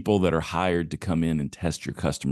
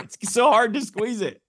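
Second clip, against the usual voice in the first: laughing. Real, not acted. Joking about squeezing corn.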